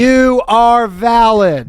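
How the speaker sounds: slow, high, drawn-out reassurance; dramatic psychic. Retold or imitated.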